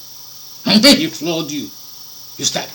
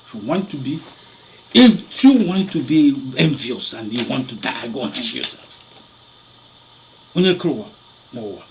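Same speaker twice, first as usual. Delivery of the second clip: ordinary talk, nothing marked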